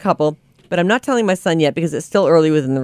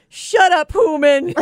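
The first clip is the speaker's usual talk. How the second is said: high-pitched mock yell; mock scream; 11 semitones higher, much slower than usual. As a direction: scream it in playful exasperation, much higher. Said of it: "I screamed".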